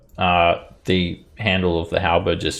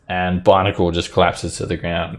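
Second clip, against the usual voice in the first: ordinary talk, nothing marked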